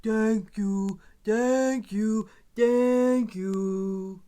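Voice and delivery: lightly voiced gentle giant; a hint of a big monster's gratitude; deep booming voice. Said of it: "deep, booming voice".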